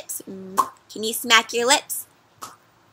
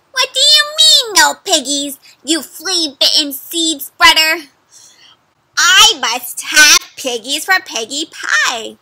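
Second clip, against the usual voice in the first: angry witch; 4 semitones higher, 7 dB louder than usual. Acted. Witch raging at the farmer over missing piggies. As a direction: rage at him like a furious witch.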